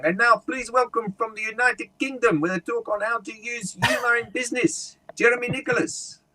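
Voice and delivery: high-pitched MC introduction; event announcer. Retold or imitated. Guy introducing the humour speaker to the audience, high announcer voice. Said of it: "the guy was literally saying".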